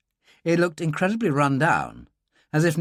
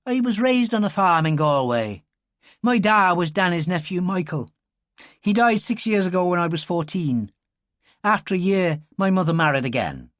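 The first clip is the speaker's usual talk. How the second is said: put-on higher young Irish girl, open and earnest; 6 semitones higher than usual. Girl telling Dylan her story, earnest Irish voice. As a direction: tell it simply, a bit exposed.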